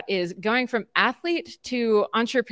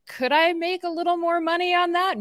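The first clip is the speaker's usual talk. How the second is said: high, sing-song timid ask; tentative request; 11 semitones higher than usual. Acted. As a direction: ask sheepishly for more money, pitched high. Acting out asking for more pay.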